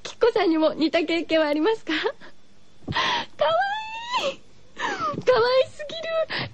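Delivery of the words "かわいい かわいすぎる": squealing high, far above her voice; gushing. Real, not acted. Radio host squealing over a listener's childhood story. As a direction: melt over how cute it is.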